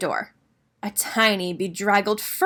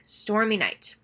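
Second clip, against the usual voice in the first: ordinary delivery, nothing marked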